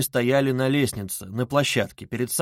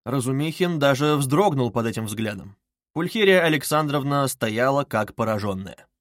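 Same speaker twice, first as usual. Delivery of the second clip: ordinary delivery, nothing marked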